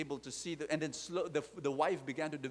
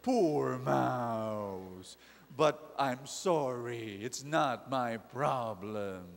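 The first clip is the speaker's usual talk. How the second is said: lower, louder, slow cow voice; slow, heavy cow brushing off the mouse; indifferent cow; 3 semitones lower, 4 dB louder, much slower than usual. Acted. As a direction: drawl it out like a bored old cow. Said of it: "And the cow said".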